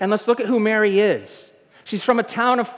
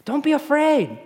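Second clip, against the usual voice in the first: lightly voiced angel; 8 semitones higher than usual. Angel comforting Mary.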